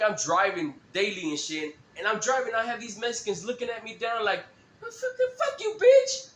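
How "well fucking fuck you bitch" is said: high, loud sneering comeback; mock angry retort. To staring drivers, a high loud angry comeback. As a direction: fire it back at them high and loud. Snapping back at staring strangers.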